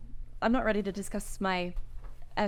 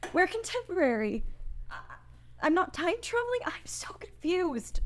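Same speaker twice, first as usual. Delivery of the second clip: confused whisper; 8 semitones higher than usual. Acted. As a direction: let it fall out in a whisper. Chris overwhelmed by the revelation.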